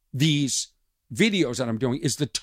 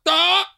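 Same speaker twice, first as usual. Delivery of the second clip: high, loud "ah" blurt; sudden high mock cry; imitated heckler noise; 5 dB louder than usual.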